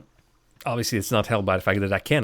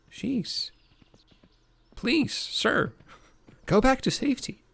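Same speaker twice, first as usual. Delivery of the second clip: high put-on voice; slower than usual. Voicing a game character.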